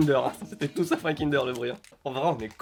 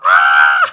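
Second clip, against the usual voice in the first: short loud cry of disgust; disgusted shout; 12 semitones lower, 18 dB louder than usual. Real, not acted. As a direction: yelp it out in disgust. Cries out in disgust.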